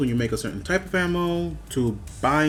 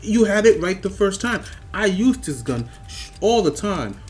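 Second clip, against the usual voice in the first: exasperated rant; 6 semitones higher, 3 dB louder than usual. Real, not acted. Ranting at the developers.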